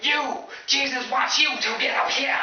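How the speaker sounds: high, loud revivalist shout; hammed-up fervent preacher yell. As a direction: call out a sinner from the pulpit. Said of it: "this preacher points at me and he says".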